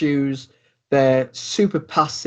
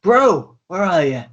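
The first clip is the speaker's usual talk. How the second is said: a lightly raised, friendly bro voice; mildly voiced robot brother; 6 semitones higher than usual. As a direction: call out to your bro, a bit higher. GM voices Finite calling his brother.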